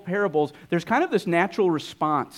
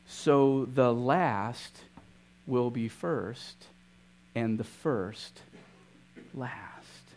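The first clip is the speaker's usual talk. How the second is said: very slow, softer and softer, ending in a whisper; fading to a hush; 4 semitones lower, 6 dB quieter, much slower than usual. Acted. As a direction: let the last words die away to a whisper. Closing line of the parable, fading.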